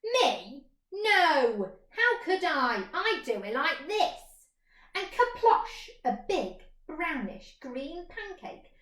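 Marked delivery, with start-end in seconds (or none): 0.0-4.5 louder, mooing cow. Acted. Cow answering the mole, loud and lowing. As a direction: moo it a little.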